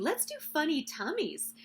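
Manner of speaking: high, playful mild shout; storybook shout. A narrator's reading. Danny starts a game with the children, playful mock shout. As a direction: call it out like an eager kid. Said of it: "Danny was shouting".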